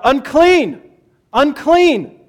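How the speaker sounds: shouting Unclean. Acted. Father telling son to shout Unclean, loud warning cry. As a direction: call it out so everyone hears. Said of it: "You have to shout".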